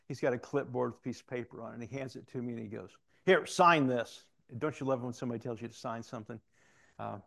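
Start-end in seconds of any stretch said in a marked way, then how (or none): 3.2-4.3 slow, loud order, higher in pitch; loud, drawn-out mock command; imitated command. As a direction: give it as a boss's blunt order. Captain orders him to sign.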